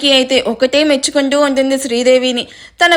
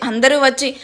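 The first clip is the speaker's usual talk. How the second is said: ordinary delivery, nothing marked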